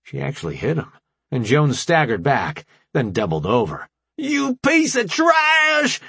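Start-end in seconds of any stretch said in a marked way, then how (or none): 4.2-6.0 slow, high, loud fury; mock anger. A narrator's reading. Rambo faking fury at Jones, slow high yell. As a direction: spit it out in hammed-up rage. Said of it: "with all the anger she could muster".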